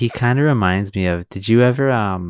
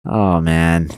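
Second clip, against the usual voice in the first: ordinary delivery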